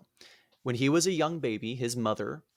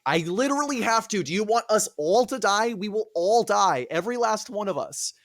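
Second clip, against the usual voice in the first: higher, louder desperate pleading; exasperated Agamemnon; 7 semitones higher, 3 dB louder than usual. Retold or imitated. Agamemnon answers Clytemnestra in higher, exasperated pleading. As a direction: plead like a cornered king. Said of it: "And Agamemnon's like".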